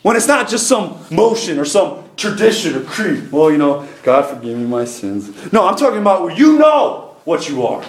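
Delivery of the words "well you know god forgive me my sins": low, perfunctory mock confession; half-hearted prayer. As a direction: imitate someone praying by rote. Mimics a half-hearted routine prayer.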